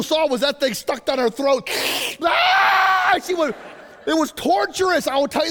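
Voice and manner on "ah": put-on very high, long baby wail; imitated newborn scream. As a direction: scream like a newborn being suctioned. Imitates newborn screaming at suction bulb.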